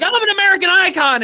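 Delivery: hammed-up American braggart, chest out; imitating the stuntman's showy American accent; mock American bravado. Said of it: "And he's like".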